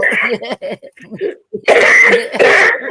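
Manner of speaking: high, loud burst of laughter. Real, not acted. Laughs at own bad handwriting.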